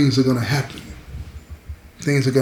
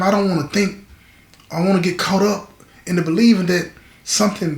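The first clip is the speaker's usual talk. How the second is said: higher-pitched, heartfelt character voice; vulnerable confessing man; 5 semitones higher than usual. Acted. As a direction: plead lightly, afraid to get your hopes up. Voices man afraid of hoping.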